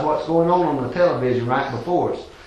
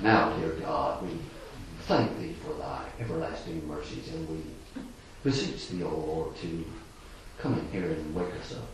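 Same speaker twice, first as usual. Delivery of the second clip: limp lifeless prayer; 11 semitones lower, 14 dB quieter than usual. Shown as a demonstration. Showing the church what fervent is not, a drowsy mumbled prayer. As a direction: mumble a dutiful prayer half asleep. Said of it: "example of what fervent is not".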